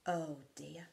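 usual speaking voice

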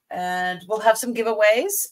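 usual speaking voice

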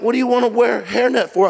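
high-pitched imitation of a baffled mother; retold disbelief. Mom questioning her son's hairnet request, retold disbelief.

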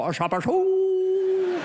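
high, slow "Ah, shabba, shoo" squeal; silly gibberish cry. Retold or imitated. [name] showing Dana his sketch idea, high silly squeal. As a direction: stretch the nonsense out high. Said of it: "Sometimes I go".

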